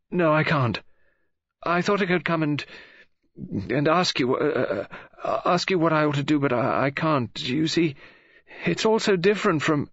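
put-on high, agitated, stumbling young woman; flustered girl. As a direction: trip over the words as nerve fails.

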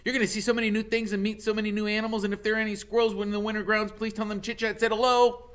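high, eager squirrel chatter; squeaky excited squirrel voice; chatty squirrel. Acted. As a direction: pester him like a nosy little squirrel. Squirrel Chit Chat rattles on.

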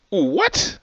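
very high, rising what; shocked squeak. Real, not acted. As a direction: squeal it in disbelief. Shocked at the twist.

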